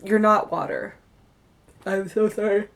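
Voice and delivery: talking through a yawn; words stretched by a real yawn. Real, not acted. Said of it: "please excuse me for my yawning".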